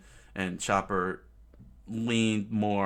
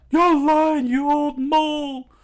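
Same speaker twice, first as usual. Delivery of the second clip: screamed, much higher and louder; furious yell; 13 dB louder than usual. Acted. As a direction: scream the accusation in rage. Usopp's furious outburst.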